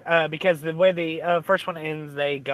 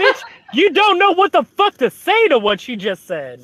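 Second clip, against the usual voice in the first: high, loud mock shout through laughter; shouting the comeback while laughing; retold jeer, high and loud with a laugh; laughing retort; 10 semitones higher, 6 dB louder than usual.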